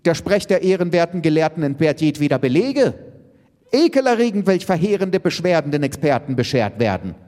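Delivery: fast, high, indignant tirade; agitated. A narrator's reading. Elke answering the experts, agitated and indignant. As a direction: rattle off an outraged complaint. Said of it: "entgegnete Elke erregt".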